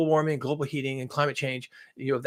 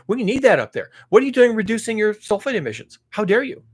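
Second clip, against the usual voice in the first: raised, louder pretend telling-off; mock indignation; 5 semitones higher, 5 dB louder than usual. Imagined critic to the Chinese, mock indignation. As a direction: scold with put-on outrage.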